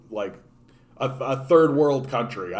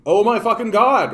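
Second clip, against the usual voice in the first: higher and louder, sarcastic oh my God; loud mock dismay; 8 semitones higher, 7 dB louder than usual. Mocking a friend who just realized, loud sarcasm. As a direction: throw it back at him, loud and mocking.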